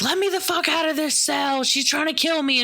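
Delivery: high-pitched panicked scream without much volume; mock screaming. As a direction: imitate a woman screaming to be let out. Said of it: "like, screaming".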